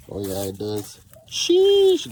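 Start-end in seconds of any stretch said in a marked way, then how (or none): 1.3-2.1 high, loud sheesh; excited sheesh. Real, not acted. Reacts to brand name.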